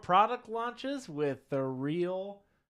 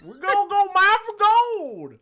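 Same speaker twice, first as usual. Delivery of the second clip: very high, loud, slow cackling prospector; old prospector; 10 dB louder, slower than usual. Pretend. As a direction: whoop like a gold-crazed old miner. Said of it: "it's my old prospector voice".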